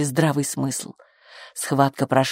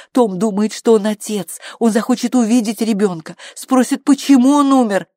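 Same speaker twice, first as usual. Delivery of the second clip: higher, building insistent protest; urgent objection; 7 semitones higher, 4 dB louder than usual. A narrator's reading. Midwife protesting against Flo's plan, urgent objection. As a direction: argue urgently, growing louder.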